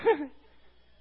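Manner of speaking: loud, high laugh; quick loud chuckle; short laugh. Real, not acted. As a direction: burst out laughing briefly. Laughs while reassuring listener.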